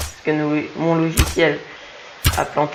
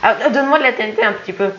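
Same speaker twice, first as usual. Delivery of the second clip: urgent excited; 6 semitones higher, faster than usual. Real, not acted. Urgent request in fight.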